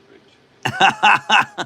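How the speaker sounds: reads the comment while laughing out loud; bursting into a loud laugh mid-sentence; laughing loudly. Real, not acted. Reading a funny troll comment.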